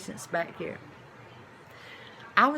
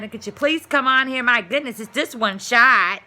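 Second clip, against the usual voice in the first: imitating irate patient; 4 semitones higher, 9 dB louder than usual. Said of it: "She wanted to know why".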